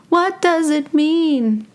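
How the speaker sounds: high, slow mock wonder; slow, playful high-pitched pondering; drawn-out questioning. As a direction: stretch every word out in a high voice. Repeats question, drawn out for effect.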